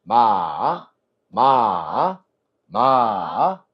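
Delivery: each 마 dipping low, then rising; long 마 that falls and climbs back; third tone. Shown as a demonstration. Teacher models third tone, 마 dipping then rising. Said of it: "여기서 뚝 떨어져".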